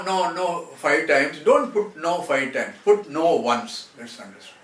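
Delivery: ordinary delivery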